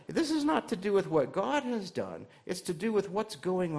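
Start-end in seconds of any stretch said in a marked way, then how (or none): none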